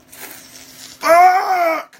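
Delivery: high, drawn-out yelled curse; re-enacted outburst. Listener cursing at his headphones, re-enacted frustrated yell. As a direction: yell it out in long, high exasperation. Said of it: "I had to mute myself".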